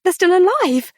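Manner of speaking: high shocked outcry; high-pitched startled cry; alarmed. A narrator's reading. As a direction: yelp at the live moths. Horror at live moths.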